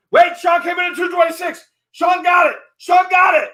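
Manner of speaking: high, loud last-second auction cry; frantic high-pitched announcer yell; excited shout. Real, not acted. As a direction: shout the surprise winning bid. Last-second winning bid announced.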